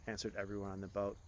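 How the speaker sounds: ordinary delivery, nothing marked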